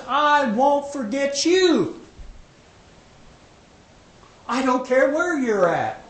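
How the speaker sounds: slow, lifted declaration that God will not forget; God's promise proclaimed. Acted. Preacher voicing God's promise.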